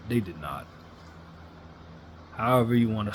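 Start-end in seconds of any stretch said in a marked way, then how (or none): 0.1-0.7 low, quiet repeat. Real, not acted. Disappointed fan on the Mavericks, low and soft. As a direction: drop lower and softer on they did not. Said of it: "And they did not".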